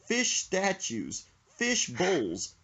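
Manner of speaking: ordinary delivery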